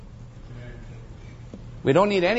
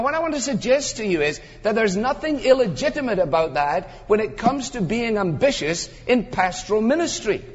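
normal talking voice

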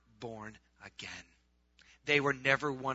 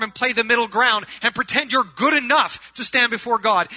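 loud, higher-pitched sermon delivery; full-force preaching; 9 semitones higher, 14 dB louder than usual. Acted. Preacher telling his congregation Jesus rejects the lukewarm, full-force preaching.

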